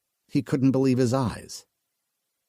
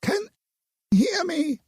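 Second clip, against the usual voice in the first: high, distorted woman calling over the rune; woman calling again over the rune. A narrator's reading. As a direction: call again through the crackling rune. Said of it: "The distorted voice came again".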